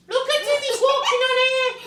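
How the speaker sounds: very high, loud cry; excited squeal. Acted. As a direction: squeal it out for all to hear.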